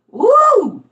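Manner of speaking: loud high woo; high loud whoop. Real, not acted. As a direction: let out a whoop. Exclaiming at prophecy.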